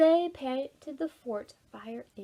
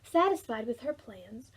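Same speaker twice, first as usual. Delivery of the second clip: usual speaking voice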